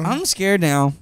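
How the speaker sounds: hammed-up fear; mock scared. Parodying the farting girl.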